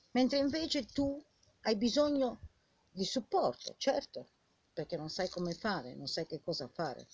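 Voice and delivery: ordinary delivery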